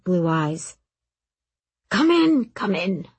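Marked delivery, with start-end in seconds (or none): 1.9-2.5 eager welcome. A narrator's reading. Lindhall welcomes them eagerly.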